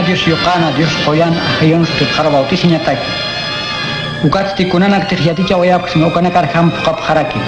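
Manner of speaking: normal talking voice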